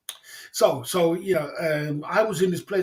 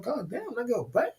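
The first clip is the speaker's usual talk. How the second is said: awed hushed; 6 semitones higher, 7 dB quieter than usual. Retold or imitated. Admiring the girl.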